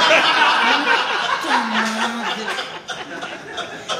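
long loud laugh; real hearty laughter. Real, not acted. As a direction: burst out laughing. Laughing at punchline.

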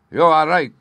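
loud, high exclamation; forceful cry of admission. A narrator's reading. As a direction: burst out with it as an agitated king. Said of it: "he cried".

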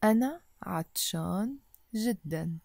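أنا عطشان جدًا said quickly. Shown as a demonstration. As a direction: rattle it off at a brisk clip. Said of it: "a bit faster".

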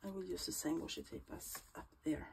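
ordinary talk

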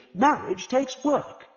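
high, slow, self-important pronouncement; pompous. A narrator's reading. Charles lectures Agatha on marriage, high and slow, pompous. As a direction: lay it down slowly like a lofty lesson. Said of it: "he said pompously".

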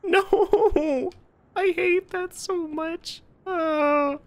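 high laughing no, then a whimpering slow cry; half laughing, half crying from fright; scared laugh-cry. Real, not acted. Gamer jump-scared, laughing and whimpering high.

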